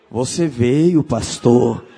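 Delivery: low, loud, menacing possessed voice; demonic voice. Retold or imitated. Possessed woman greets the pastor in a low, loud demon voice. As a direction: growl it out like a demon.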